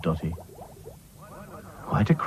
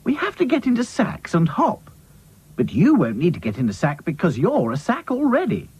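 high-pitched bossy character voice; bossy doll; 8 semitones higher than usual. A narrator's reading. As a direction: explain briskly as the doll in charge.

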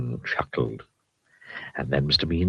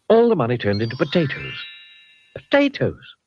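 usual speaking voice

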